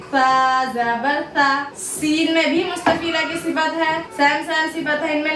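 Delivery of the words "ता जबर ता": ता जबर ता drawn out slowly and softly; careful Quran letter drill. Shown as a demonstration. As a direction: sound out ता gently and slowly. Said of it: "नरमी के साथ पढ़ेंगे".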